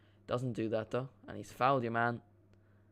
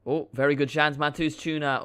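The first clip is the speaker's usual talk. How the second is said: loud, raised-pitch football commentator burst; real surge of match excitement; excited commentary; 4 semitones higher, 11 dB louder than usual. Real, not acted. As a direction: call the big chance as it happens. Live match commentary, chance at goal.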